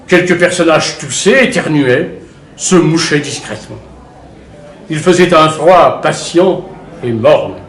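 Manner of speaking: usual speaking voice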